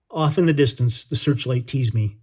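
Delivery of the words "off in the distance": ordinary delivery, nothing marked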